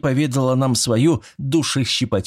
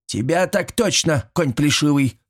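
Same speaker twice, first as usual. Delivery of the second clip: scornful huff; 6 semitones higher than usual. A narrator's reading. Old woman jibes at her old sweetheart, scornful. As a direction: huff the insult at an old friend. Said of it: "хмыкнула подруга".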